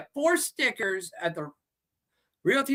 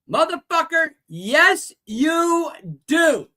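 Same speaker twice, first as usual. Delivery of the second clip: higher, louder comeback; annoyed retort; 5 semitones higher, 4 dB louder, slower than usual. Real, not acted. Seller shooting back at people who claim no sticker exists, annoyed. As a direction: throw it right back at them.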